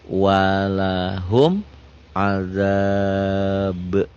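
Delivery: very slow, drawn-out reading of "Walahum adzab"; slow recitation. Shown as a demonstration. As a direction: stretch each syllable. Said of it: "Tulisannya begini".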